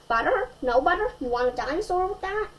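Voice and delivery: lightly voiced vendor calling out; popcorn man shouting. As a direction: sell it like a theater vendor, mildly. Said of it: "the popcorn man shouting".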